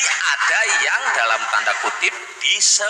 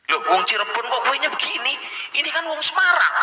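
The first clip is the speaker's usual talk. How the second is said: mock indignant surprise; 10 semitones higher than usual. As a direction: catch the impostor out. Local exposing impostor.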